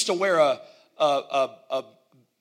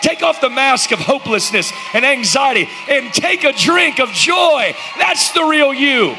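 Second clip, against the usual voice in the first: full-force preaching; 7 semitones higher, 9 dB louder than usual. Acted. Preacher exhorting the congregation.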